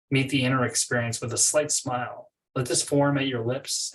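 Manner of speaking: ordinary delivery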